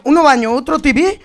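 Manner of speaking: loud repeated callout; shouting orders over battle. Real, not acted. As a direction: shout it to the team. Aviso repetido, más fuerte. (aviso gritado al equipo)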